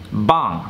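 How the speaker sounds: 'Bang' in a short, sharp fall; correct tone. Shown as a demonstration. Teacher repeating the word for students, correct falling tone. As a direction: say 'Bang' falling firmly to low. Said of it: "You have to say it just like this".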